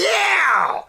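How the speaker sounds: sudden high excited yelp; excited cheer. Real, not acted. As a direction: burst out cheering. Excited cheer mid-reading.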